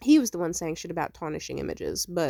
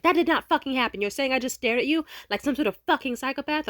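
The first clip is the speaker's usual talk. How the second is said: raised, louder retelling of his yelling; mock angry shout; 7 semitones higher, 4 dB louder than usual. Ex yelling into the phone in outrage, mock shouting. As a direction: yell it at her like he's outraged. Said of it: "shouting and screaming into the phone".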